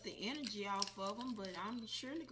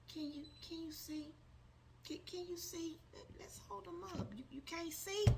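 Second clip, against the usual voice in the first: pleading; 8 semitones higher, 5 dB quieter than usual. Real, not acted. Pleading with viewers to see.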